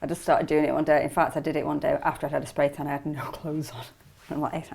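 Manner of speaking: ordinary talk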